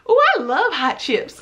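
a hint of an eager child; begging kid. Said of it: "the kid come over like".

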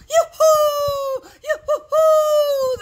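high, loud yoo-hoo; visitor's excited halloo; calling out. Acted. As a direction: hoot a long, high greeting. Grandmothers calling on arrival.

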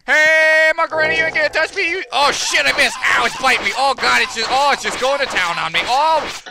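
loud, high frantic shouting; frantic high-pitched outburst; panicked gamer yelling. Real, not acted. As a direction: yelp in alarm as you get attacked. Attacked by zombie in game.

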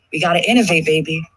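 normal talking voice

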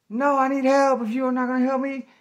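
high, loud acted protest; re-enacted frantic high-pitched plea; desperate yelling. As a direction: shout back, demanding help. Acts arguing desperately with police.

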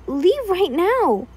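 put-on same girl spacing out the order to leave; slow firm order. A narrator's reading. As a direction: Leave right now spelled out slowly, word by word. Ordering him out.

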